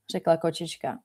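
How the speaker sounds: plain reading voice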